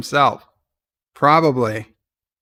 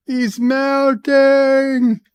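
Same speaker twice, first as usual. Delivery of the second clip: drawn-out melting voice; 10 semitones higher, 3 dB louder, much slower than usual. Pretend. Watching Odo melt on screen.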